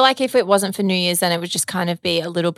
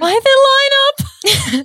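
high, slow laughing repeat of her words; mock her phrase through laughter; laughing echo; 5 dB louder, much slower than usual. Laughing while repeating her co-host's words. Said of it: "Oh, their accents".

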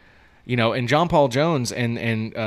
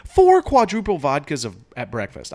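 disbelief; 5 semitones higher, 7 dB louder than usual. Real, not acted. Disbelief at the drinking.

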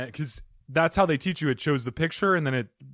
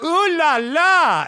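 much higher, drawn-out and louder, mock French flirt; hammed-up French ooh la la; 12 semitones higher, 7 dB louder, much slower than usual. Salesman luring mall moms, camp French call. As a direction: stretch it out high like a cartoon Frenchman. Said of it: "I'd just scream in French".

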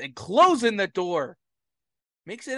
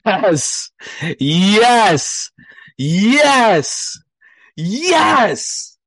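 ecstatic shouting; 3 semitones higher, 10 dB louder, much slower than usual. Real, not acted. Celebrating Philly sports weekend.

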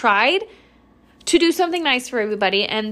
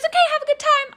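much higher, bright attendant voice; cheery staff voice; faster than usual. Retold or imitated. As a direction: chirp it like the friendly gatekeeper. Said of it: "She goes".

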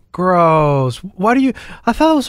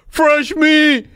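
high leering manatee; 11 semitones higher than usual. Acted. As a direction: squeal it like a giddy sea cow. Repeating manatee line, laughing.

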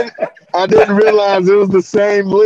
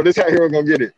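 usual speaking voice

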